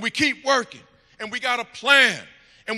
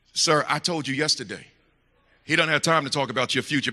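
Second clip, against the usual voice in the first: lower secretary voice; 7 semitones lower than usual. Retold or imitated.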